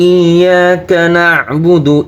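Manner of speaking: slow, drawn-out melodic recitation; chanted Quranic tilawat. Shown as a demonstration. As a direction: recite slowly with long held vowels.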